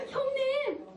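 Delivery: high, eager call to big brothers; David calling out. Acted. David arriving on an errand to his brothers, high call.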